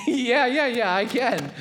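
laughing, eager agreement; laughing yes. Acted. Paul delighted to be asked, laughing yes. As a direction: chuckle through the yeses as a delighted Paul.

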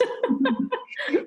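a burst of high laughter; high bright laugh. Real, not acted. Laughing again.

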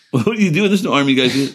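high incredulous mock challenge; mocking the Marines. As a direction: play the baffled Marines, pitch it up. Quoting the surprised Marines.

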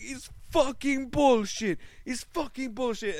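high, loud, dragged-out outburst that loses volume on the repeat; retold passionate yell. Israeli guy ranting about covid tests by the pool, high and loud. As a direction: vent it like a man fed up with the rules. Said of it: "they fucking yell".

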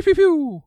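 high, loud pew pew; laser sound effect. Retold or imitated. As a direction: zap it like a cartoon laser. Mimicking the laser rays.

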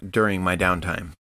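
ordinary talk

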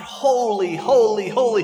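loud cry of holy; loud angelic proclamation. A narrator's reading. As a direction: call it out like the seraphim. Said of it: "They cry out".

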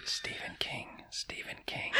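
spooky, breathy whisper of the name; creepy whisper. Retold or imitated. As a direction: whisper it like a horror teaser. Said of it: "Why are you whispering Stephen King".

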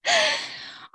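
a short high giggle. Real, not acted. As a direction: giggle lightly, amused. Host laughs before next turn.

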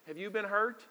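ordinary delivery

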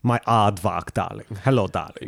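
low, put-on South African lady; a hint of a posh South African woman; South African accent. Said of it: "replaced the South African woman".

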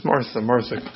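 soft, calming repetition of her name; a touch of reassuring calm; soothing. Acted. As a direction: settle her down gently. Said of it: "Jesus comes and he says".

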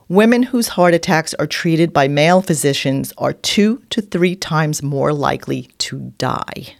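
ordinary talk, nothing marked

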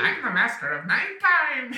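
high, loud boasting villain; gloating villain. Pretend. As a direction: crow it like a giddy night lord. S1 voices Samhain boasting.

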